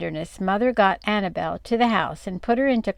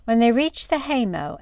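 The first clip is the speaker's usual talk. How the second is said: plain reading voice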